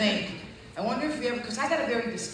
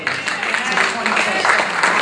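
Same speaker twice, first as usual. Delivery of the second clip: loud, slow, high proclamation; preaching shout; 4 semitones higher, 18 dB louder, slower than usual. Real, not acted. Preacher proclaiming to the congregation, slow loud shout. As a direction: belt it out to the congregation.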